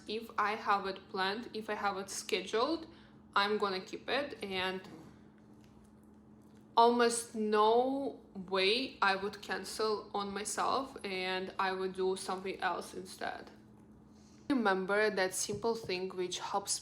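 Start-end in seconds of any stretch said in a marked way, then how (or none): none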